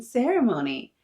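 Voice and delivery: high, loud finish on good news; excited child. A narrator's reading. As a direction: land the big news proudly. Child announces proud news.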